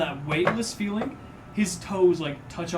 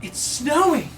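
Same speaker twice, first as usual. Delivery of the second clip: high, bright cry of wonder; thrilled onlooker; delighted bystander; 11 semitones higher, 6 dB louder than usual. Acted. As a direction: call it out like a child seeing snow. Crowd sees snowflake fireworks.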